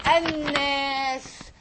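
"annas" with doubled N and long A; slow, drawn-out "annas"; shadda example. Shown as a demonstration. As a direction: stretch "annas" out as an-naaas. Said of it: "give you again another example".

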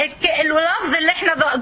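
normal talking voice

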